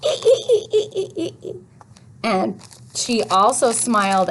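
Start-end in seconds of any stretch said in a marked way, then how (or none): 0.0-1.6 high-pitched "ee-ee-ee" giggle falling away; Happy Y's giggle. Acted. Teacher voicing a story character's laugh, high giggle. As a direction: giggle brightly on a repeated long E. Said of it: "what sound did she say".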